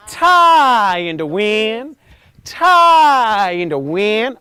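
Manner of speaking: Tie in the wind, loud and very slow, rising on Tie; slow loud intonation. Shown as a demonstration. Coach leading warm-up, loud slow chant. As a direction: let the words sway like a tree. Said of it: "put that together".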